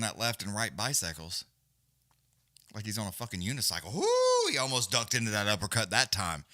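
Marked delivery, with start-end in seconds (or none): 3.9-4.5 short high shouted whoo; excited whoop. Real, not acted. Commentator whoops at a close call. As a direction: yelp with excitement at the exchange. Near uppercut exchange.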